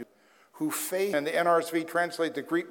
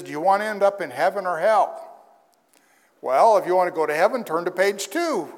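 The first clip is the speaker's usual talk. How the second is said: a salesy preacher reading the tract aloud, louder; mock pamphlet pitch; 3 semitones higher, 8 dB louder, faster than usual. Pastor mimicking a campus crusader's tract, salesy and loud. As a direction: sell heaven like a pushy recruiter.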